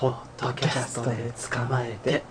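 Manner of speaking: odd put-on voice. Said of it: "なんか変な合図言ったでしょ".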